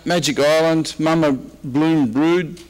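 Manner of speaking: usual speaking voice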